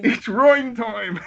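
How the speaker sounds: high, loud, slow hammy quote; character catchphrase. Retold or imitated. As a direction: belt it like a cartoon hero. Echoing the game catchphrase.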